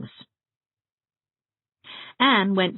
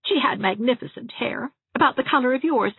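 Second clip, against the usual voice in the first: put-on higher, chatty gossiping old dressmaker; higher-voiced prim spinster relishing every funeral; Miss Valentine; 10 semitones higher than usual. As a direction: gossip cheerfully about the dead.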